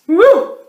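very high, loud woo; elephant whoop. Acted. Elephant trumpets and jumps.

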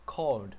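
"Called" closed with a D; "Called" as one syllable ending in D; final D sounded. Shown as a demonstration. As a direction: say "Called" with the past-tense D at the end. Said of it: "phát âm cái chỗ này nó là âm D".

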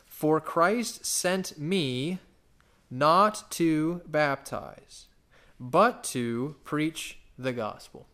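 slow, drawn-out reading; scripture reading. Acted. Preacher quoting Paul to the church, slow and weighty. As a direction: stretch each phrase out for weight. Preacher reads Paul's verse.

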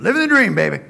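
loud and high, stretching out "dream"; demonstrating the catchphrase for the congregation at full volume. Shown as a demonstration. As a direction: practice take belted with full gusto. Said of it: "You have to practice a couple".

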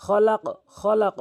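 খলাক said slowly and loudly, the final k bounced hard. Shown as a demonstration. Teacher demonstrating the qalqala stop in খলাক. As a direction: land on the k of খলাক with a firm bounce. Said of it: "অক্ফ করলে খলাক".

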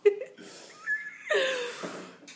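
a high, hearty burst of laughter. Real, not acted. Laughing at her mother's pronunciation, high burst of laughter. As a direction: crack up at the mangled word.

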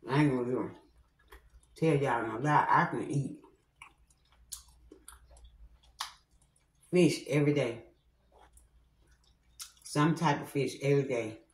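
talking between bites; speaking while chewing food; mouth full, chewing. Real, not acted. Seen 2: hand to mouth eating repeatedly while speaking.

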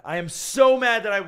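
venting loudly at the camera; frustrated outburst. Real, not acted. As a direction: let the anger spill out.

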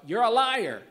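sudden full-voiced shout, pitched high; shouted accusation. Woman at a church meeting shouting at the preacher, loud outburst. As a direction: hurl it out as a loud cry of protest. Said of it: "I wanted to stand up and shout".

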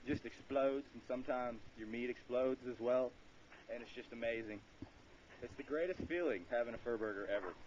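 ordinary talk, nothing marked

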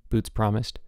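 ordinary delivery

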